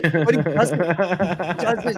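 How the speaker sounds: real laughter breaking into the words; laughing. Real, not acted. Teller cracks up at friend's one-liner, laughing.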